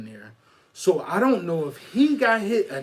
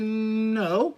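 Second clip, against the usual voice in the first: a short, higher, blunt "No"; flat no; 5 semitones higher than usual. Retold or imitated. Teller replaying his own blunt answer to the question. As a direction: shut it down with one word. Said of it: "that's exactly how I went".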